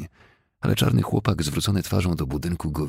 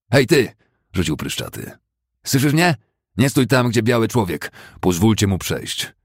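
loud, much higher bully's yell; angry shout; 6 semitones higher, 5 dB louder than usual. Acted. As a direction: bark it at him. Pryszczaty shouting at the boy.

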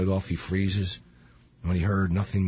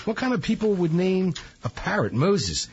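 mocking disbelief; 9 semitones higher than usual. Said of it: "He goes".

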